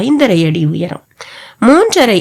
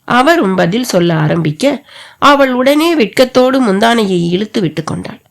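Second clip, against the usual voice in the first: ordinary delivery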